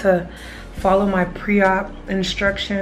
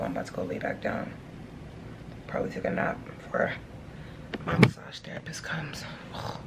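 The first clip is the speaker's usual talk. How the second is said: weak murmur; 4 semitones lower, 12 dB quieter than usual. Real, not acted. Exhausted after surgery.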